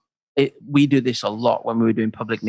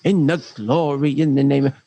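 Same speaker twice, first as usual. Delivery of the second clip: preacher's special voice; 3 semitones higher than usual. Acted. Speaker mimicking a man on the church platform, affected preacher voice. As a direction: put on a grand church-platform voice. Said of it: "the man who does the special voice".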